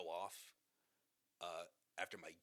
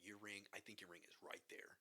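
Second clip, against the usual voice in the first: softer, whispered certainty; whispered conviction; 8 dB quieter than usual. Retold or imitated. Friend pointing out the ring, soft whisper. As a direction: whisper it as sure fact. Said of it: "he said it with such conviction".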